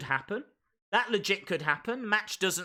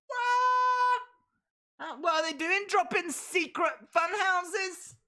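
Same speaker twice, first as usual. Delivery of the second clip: much higher, astonished what; shocked; 12 semitones higher than usual. Real, not acted. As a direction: squeal in disbelief. Shocked by the clip.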